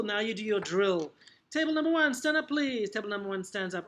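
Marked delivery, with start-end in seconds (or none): 1.5-2.9 high, loud classroom command; calling on pupils. Acted. Teacher calling table one to stand, calling out. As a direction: project it to the whole room.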